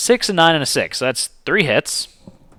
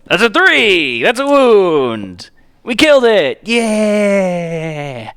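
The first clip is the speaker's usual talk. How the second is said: high, loud victory yells with long-held endings; jubilant shouting; 10 semitones higher, 7 dB louder, much slower than usual. Real, not acted. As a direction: scream it out, the winning roll just landed. Winning roll kills the monster.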